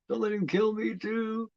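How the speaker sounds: imitating a frightened girl; scared plea. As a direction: beg for your life.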